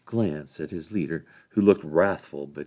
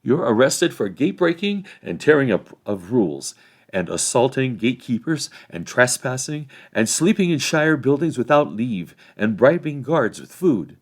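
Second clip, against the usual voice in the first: raised, loud list of charges; officious sheriff; 5 semitones higher, 8 dB louder than usual. A narrator's reading. Sheriff leader arresting Frodo, officious and loud. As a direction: reel off charges self-importantly.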